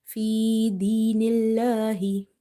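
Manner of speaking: "الله" with a light, thin l and long vowel; light lam. Shown as a demonstration. Teacher showing the lam is light, light-l recitation. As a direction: recite "الله" keeping the l light, never heavy. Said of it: "never make it heavy while reciting".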